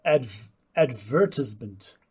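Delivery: "advertisement" slowly tried out as ad-VER-tis-ment; trying British stress. Shown as a demonstration. Co-host tests the British way, slow "advertisement" stressed on VER. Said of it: "the British pronunciation of advertisement".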